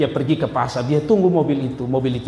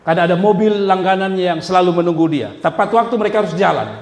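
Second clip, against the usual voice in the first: ordinary talk, nothing marked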